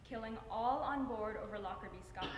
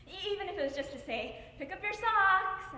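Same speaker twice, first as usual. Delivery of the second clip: put-on calling out to a teenage son; motherly call; 7 semitones higher, 7 dB louder than usual. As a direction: build to a loud, high motherly order. Mother imagines scolding her son.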